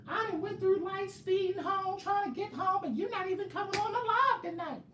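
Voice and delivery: very high squeaky complaining voice; mocking a fan's voice. Mocking Bianca rushing home for the live, squeaky high whine. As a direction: whine like an impatient fan. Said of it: "that's how I imagine y'all sounding".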